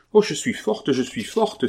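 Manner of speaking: high, urgent protest; exclaiming. A narrator's reading. Sick woman insisting to her friend, exclaiming. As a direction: cry out insistently, pitch leaping at the start. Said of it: "s'écria Carmélite".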